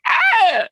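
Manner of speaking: sudden high yelp of fright; a short squealed ah; mock scream. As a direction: yelp like a falling toddler. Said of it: "You're like".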